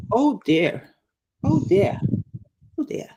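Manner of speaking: put-on copying Fluffer's accent on "Oh dear", dear without the r; imitating Fluffer. Friend finding Fluffer's saying adorable, imitating the accent. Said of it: "every time Fluffer say".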